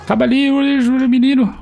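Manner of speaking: hyped high-pitched finish him; excited urging. Real, not acted. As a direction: push the teammate on, voice way up. Urging teammate to finish kill.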